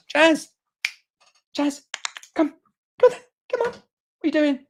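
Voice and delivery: very high, coaxing call; calling the pet. Real, not acted. Host breaks off to call Jasper over, high-pitched coaxing.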